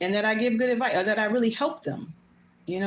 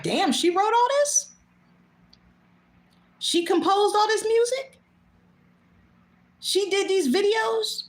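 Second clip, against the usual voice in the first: imagined amazement; 7 semitones higher than usual. Retold or imitated. Imagined viewer amazed by her work.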